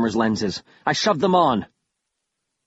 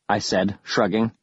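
usual speaking voice

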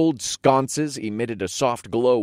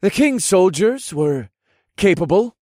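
high, louder character voice, measured pace; thoughtful; 12 semitones higher, 8 dB louder than usual. Acted. Riondale answering over the couch back, thoughtful high voice. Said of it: "said over the back of the couch in a thoughtful tone".

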